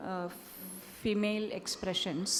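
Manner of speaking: usual speaking voice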